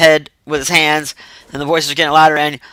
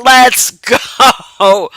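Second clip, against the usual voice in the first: higher, pushy cat voice; egging-on cat; 7 semitones higher than usual. Retold or imitated. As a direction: goad him like the devilish cat. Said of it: "The cat was like".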